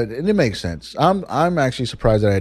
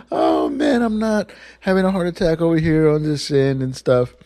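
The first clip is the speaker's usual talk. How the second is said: much higher sarcastic "good joke" with a strained chuckle; sarcastic forced laugh; 9 semitones higher than usual. Retold or imitated. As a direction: laugh it off through clenched annoyance. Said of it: "I was so mad, but I was just like".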